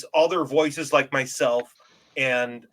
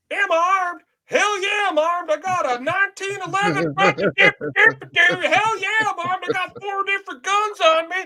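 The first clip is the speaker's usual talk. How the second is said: much higher mock bragging shout-voice; Max's reply; 3 dB louder than usual. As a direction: crow it up high like a proud hothead. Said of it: "my favorite part is".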